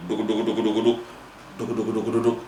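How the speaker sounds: low, soft "duk-duk-duk" belly rumble; quick, low run of thudding syllables; rumbling stomach noise. Retold or imitated. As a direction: imitate a growling, hungry tummy. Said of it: "perutnya mulai berbunyi".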